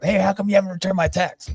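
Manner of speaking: high-pitched imitation of nagging; put-on high voice, giving someone grief; mock complaint. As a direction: whine it like a friend giving crap. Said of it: "he gave me crap".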